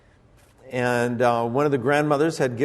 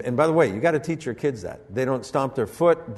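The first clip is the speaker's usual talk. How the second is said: ordinary talk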